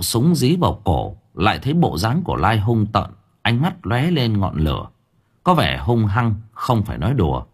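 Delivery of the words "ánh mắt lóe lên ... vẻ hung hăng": ordinary delivery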